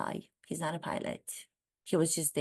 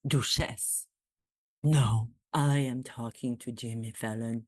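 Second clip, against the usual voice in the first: lower, posh prince impression; put-on royal voice; 5 semitones lower than usual. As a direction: play the prince, low and proper. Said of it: "I got into that voice".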